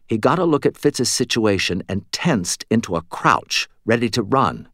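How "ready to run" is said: usual speaking voice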